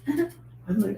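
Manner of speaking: normal talking voice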